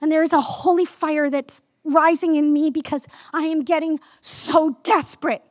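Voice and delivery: ordinary talk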